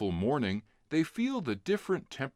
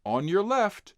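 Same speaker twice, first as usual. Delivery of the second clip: louder, higher warning call; 8 semitones higher, 5 dB louder than usual. Acted. Passing cyclist warning the kids, loud call. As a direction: call it ahead to riders. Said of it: "calls out".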